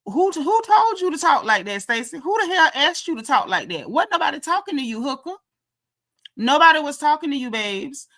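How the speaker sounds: high-pitched scolding, as if she were there; indignant telling-off. Pretend. Indignant telling-off of Stacey, as if present.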